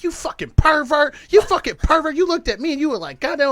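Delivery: high, loud furious yelling; mock angry yelling. Girl at the stoplight yelling at the driver, high and loud. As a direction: scream it at a stranger, outraged. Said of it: "She's like".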